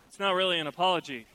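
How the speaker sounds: ordinary delivery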